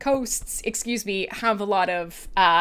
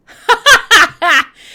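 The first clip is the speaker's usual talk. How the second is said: high, much louder burst of laughter; 10 semitones higher, 14 dB louder than usual. Real, not acted. Host cracking up over her kielbasa joke, loud laugh. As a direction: break up laughing.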